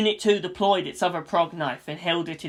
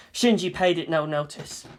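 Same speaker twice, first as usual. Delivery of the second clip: usual speaking voice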